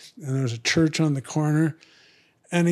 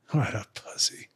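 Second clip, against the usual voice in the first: whispered under the breath, much softer; scornful aside; 6 semitones lower, 8 dB quieter than usual. Real, not acted. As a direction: toss off a contemptuous jab under your breath. Scornful aside about crying man.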